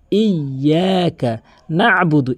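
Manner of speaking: "ইয়াকা" with a long doubled y, "নাবুদু" with throaty ayn; correct pronunciation. Shown as a demonstration. Teacher showing the correct reading, slow careful recitation. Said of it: "শুদ্ধ করে উচ্চারণ করতে চান".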